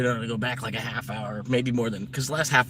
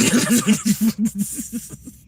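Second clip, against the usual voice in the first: loud laugh; 11 semitones higher, 8 dB louder than usual. Real, not acted. Laughing at his own joke.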